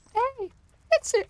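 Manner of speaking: strained attempt at a high fairy squeak; Navi. Streamer failing to do the game fairy, high-pitched. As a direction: push into a very high falsetto, then give up. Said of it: "I can't do that high pitched".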